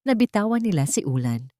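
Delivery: ordinary delivery, nothing marked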